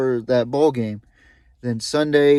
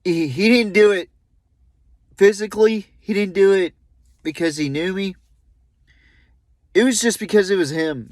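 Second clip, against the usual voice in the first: higher, louder, halting with real emotion; real grief rising in the voice; emotional; 7 semitones higher, 3 dB louder than usual. Real, not acted. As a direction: push through the emotion in broken phrases. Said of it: "getting a little emotional right now".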